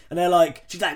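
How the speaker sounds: normal talking voice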